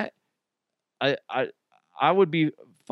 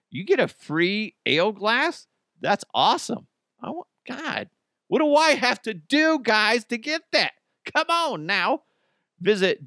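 high-pitched, over-the-top gushing; mock-desperate, squeaky-high enthusiasm; hammed-up excitement; 9 semitones higher than usual. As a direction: act thrilled beyond belief. Gushing over free glass in ad.

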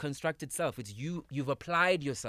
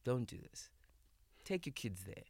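soft, low inner voice; hushed inner guidance; 3 semitones lower, 9 dB quieter than usual. Retold or imitated. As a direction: quietly voice the guiding voice. Inner voice quoted softly.

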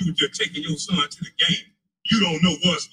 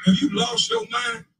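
angry shout. Real, not acted. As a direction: yell it at him, fed up. Angry callout at the co-host.

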